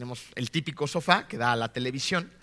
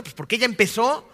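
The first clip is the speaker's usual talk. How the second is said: higher, louder, lightly acted childish excuse; mock blame-shifting; 7 semitones higher, 7 dB louder than usual. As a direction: play a spouse whining about who started it. Acted spouse blaming the other. (reproche infantil fingido, más agudo y fuerte)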